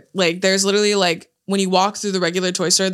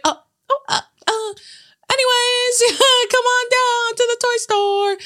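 very high, stammering mock elf; squeaky flustered recovery into a sales call. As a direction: imitate a caught-out greeter covering up cheerily. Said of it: "She literally was like".

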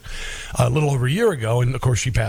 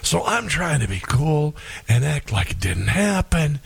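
ordinary talk

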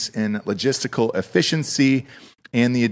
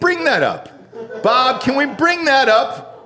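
laughing excitement; 12 semitones higher, slower than usual. Real, not acted. Laughing, demanding the clip.

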